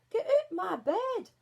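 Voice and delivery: high, loud, slow protest; cross dwarf Greety. Acted. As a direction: bark it at the intruder. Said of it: "said Greety".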